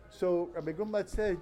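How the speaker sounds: normal talking voice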